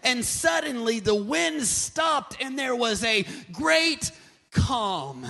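loud, dramatic scripture retelling; slow to a booming climax, then drop the pitch; full-force preaching. Real, not acted. Preaches the Bible story.

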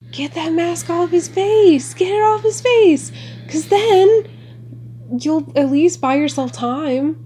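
high, loud urgent shouting; yelling at the screen. Real, not acted.

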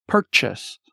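normal talking voice

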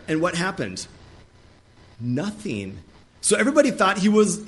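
ordinary delivery

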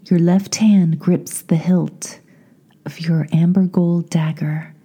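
slow, spacious meditation voice with long pauses; unhurried, projected visualisation lead; guided meditation. Acted. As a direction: guide the listener inward, phrase by phrase.

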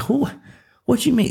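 high, startled squeak of disbelief; mock disbelief. Traveller told the flight is delayed, high startled disbelief. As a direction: act floored by the news, voice jumping high. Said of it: "I'm like".

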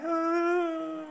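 high, hammed-up fake wail; fake cry. Potiphar's wife faking tears for the servants, mock wail. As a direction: put on a phony sob. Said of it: "she starts to fake cry".

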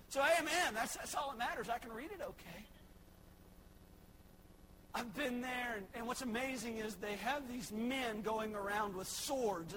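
normal talking voice

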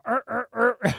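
short repeated mock growls; imitated growl. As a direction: grunt irritably in mimicry, three bursts. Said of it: "he growls at his servant".

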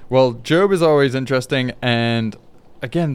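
ordinary talk, nothing marked

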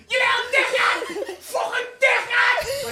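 very high, drawn-out yelled insult; furious scream. Acted. Man charging back down the street, screaming. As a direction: scream it slowly at full volume. Re-enacts row with kid in street.

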